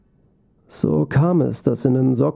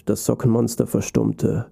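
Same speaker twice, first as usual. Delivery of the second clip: usual speaking voice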